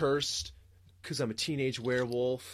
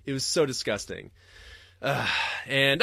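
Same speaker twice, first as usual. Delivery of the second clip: usual speaking voice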